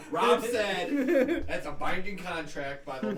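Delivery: laughing through the quote. Real, not acted. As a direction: giggle the line out. Laughing while quoting Rob.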